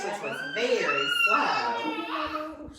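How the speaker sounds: slow it right down to show slowness; dragged-out storyteller emphasis; drawn out slowly. A narrator's reading. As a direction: stretch the words out very slowly. Slowness stressed while reading to child.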